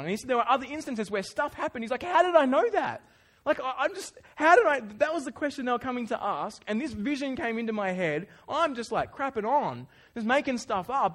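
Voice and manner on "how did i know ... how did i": high, loud, incredulous; baffled disbelief. Retold or imitated. Mate retelling his shock at the true vision, high and loud. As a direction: ask it stunned, voice shooting up.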